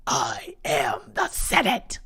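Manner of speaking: slow, drawn-out movie-villain quote; quoted villain line. Retold or imitated. Quoting a viewer's joke about Trudeau, mock villain voice. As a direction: lightly voice a sinister emperor, slowly. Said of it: "Trudeau is basically".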